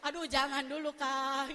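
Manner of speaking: loud, playful pleading; mock protest. Called by the Lord too soon, mock pleading. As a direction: beg off loudly, half joking.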